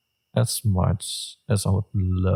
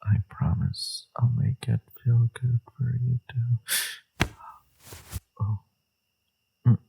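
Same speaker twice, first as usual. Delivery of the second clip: pleading seducer; 3 semitones higher than usual. Acted. Injured lover begging companion for a soothing kiss, pleading seductively.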